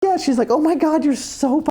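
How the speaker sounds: high squealing delight; thrilled exclamation; excited. Retold or imitated. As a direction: gush with excitement. Cheer and friend's delighted reply.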